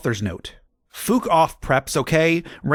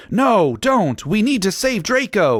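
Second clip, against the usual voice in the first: high, pleading and louder; hammed-up begging; 9 semitones higher, 4 dB louder than usual. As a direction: beg him desperately. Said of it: "we begged".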